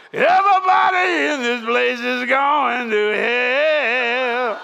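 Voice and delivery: slow, loud, high shout across the room; hammed-up shouted warning; blurted shout. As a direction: bellow it instead of the lyric. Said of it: "but I didn't say that".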